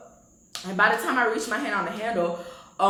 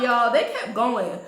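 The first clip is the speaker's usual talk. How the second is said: ordinary delivery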